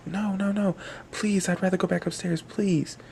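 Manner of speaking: high, frightened begging; scared pleading. Acted. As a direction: plead to get away from the beast, voice up high. Guest begs to go back.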